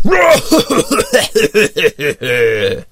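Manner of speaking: long, drawn-out villain cackle; evil laugh. Acted. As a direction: cackle like a mad sea captain. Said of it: "he did his evil laugh".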